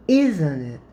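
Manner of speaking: "Isn't it?" stressed, then the pitch falls away; falling intonation. Shown as a demonstration. Teacher demonstrating the tag to students, voice dropping. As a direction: bring the voice down on "Isn't it?" to invite agreement. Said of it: "the voice goes down here".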